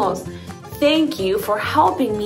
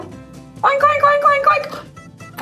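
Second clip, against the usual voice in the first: squeaky high oinking; high pig oinks; much slower than usual. Pretend. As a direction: oink like a little piggy. Pig sounds for kids.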